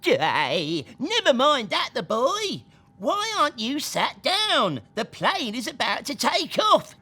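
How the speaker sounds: hint of a fussing grandad. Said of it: "Grandad voiced in story".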